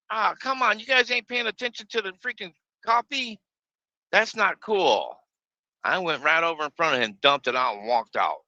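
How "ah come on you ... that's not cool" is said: mad. Retold or imitated. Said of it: "I was mad".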